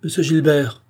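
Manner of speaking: high, loud, forced lightness; failed attempt at laughter. A narrator's reading. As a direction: call out with strained false ease. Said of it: "en essayant un rire mal réussi".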